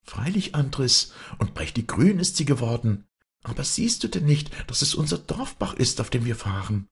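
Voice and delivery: put-on high-pitched girl's voice; eager girl. A narrator's reading. Girl to young man in the boat, eagerly in high voice. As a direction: answer in a high, lively girl's voice. Maren answers Andres in the boat.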